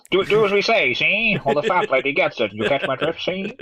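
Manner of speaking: hammed-up 1940s movie voice; nineteen-forties wise guy. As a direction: talk like a forties studio tough. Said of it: "suddenly he went all nineteen forty".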